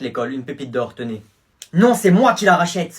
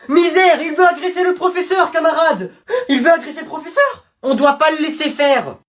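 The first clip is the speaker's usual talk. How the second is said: high, loud alarm with laughter; mock panic; 9 semitones higher, 5 dB louder than usual. Classmates hamming up fear the teacher gets attacked, loud and high.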